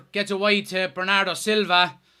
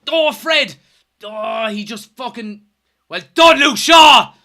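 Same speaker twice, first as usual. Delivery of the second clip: excited shout; 2 semitones higher, 7 dB louder, slower than usual. Real, not acted. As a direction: yell his name in delight. Cheering a tackle.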